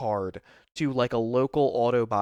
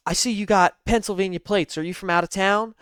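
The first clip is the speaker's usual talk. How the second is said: higher-pitched bad Southern accent; Clarice Starling; 6 semitones higher than usual. Older lady cop questioning a newcomer, bad Southern accent. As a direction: put on a hammy Southern accent. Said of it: "a bad Clarice Starling accent".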